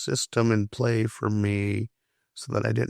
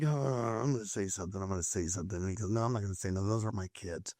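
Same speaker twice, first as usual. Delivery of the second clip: lower, much softer voice, as if talking to himself; hushed, low imitated grumbling to oneself; muttered self-debate; 6 semitones lower, 10 dB quieter, much faster than usual. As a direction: mumble an imitated inner argument under your breath.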